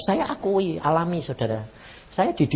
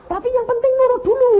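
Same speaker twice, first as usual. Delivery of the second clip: much higher and louder, almost a shout; high preaching cry; 6 dB louder than usual. Real, not acted. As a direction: soar up on the key point. Preacher climax on obedience. (seruan tinggi penuh semangat)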